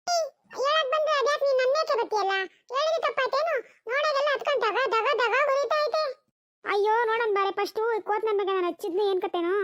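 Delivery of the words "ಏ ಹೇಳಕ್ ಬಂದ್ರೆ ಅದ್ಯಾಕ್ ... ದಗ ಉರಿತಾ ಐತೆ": put-on high child's voice, hurt and insistent, slowing at the end; a little boy arguing back; boy insisting the hay is burning; child protesting. Child protests being scolded.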